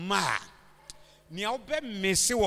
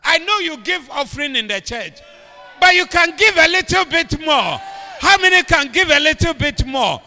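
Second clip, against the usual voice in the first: preaching shout; 6 semitones higher, 14 dB louder than usual. Real, not acted. Preacher at full force.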